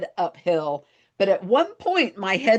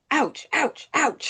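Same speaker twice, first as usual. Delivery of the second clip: mock ouches, pitched up; retold ouches; 5 semitones higher, slower than usual. Retelling her tumble downhill, mock pained ouches. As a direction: imitate each bump of a tumble, lightly. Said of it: "three different times going".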